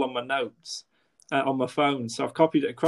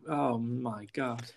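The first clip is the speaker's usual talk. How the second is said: usual speaking voice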